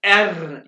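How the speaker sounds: एर said loud with a vibrating r; rolled R. Shown as a demonstration. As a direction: trill the r in एर. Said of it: "इस one को vibrate करना है".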